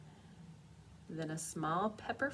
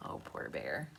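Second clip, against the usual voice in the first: ordinary delivery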